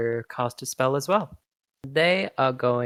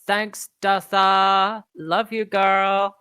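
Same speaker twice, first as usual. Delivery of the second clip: slow, drawn-out thanks, a little louder; affectionate shout-out; 7 semitones higher, 4 dB louder, slower than usual. Acted. Fantasia calling down to Dirtha fondly, slow and drawn out. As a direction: call it out fondly and slowly. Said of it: "they yell out to Dirtha and they say".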